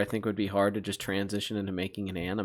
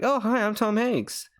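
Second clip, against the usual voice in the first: much higher, mock Tom Hanks greeting; lightly voiced Tom Hanks, far higher; Tom Hanks impression; 12 semitones higher than usual. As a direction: squeak out a cartoonish Tom Hanks hello. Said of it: "he was like".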